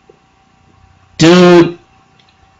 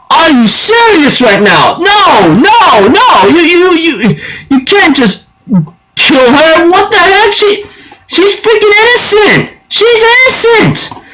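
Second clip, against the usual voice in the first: upset outcry; 11 semitones higher than usual. Retold or imitated. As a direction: cry out in disbelief that she was killed. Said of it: "I think in my mind".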